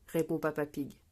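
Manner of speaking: ordinary delivery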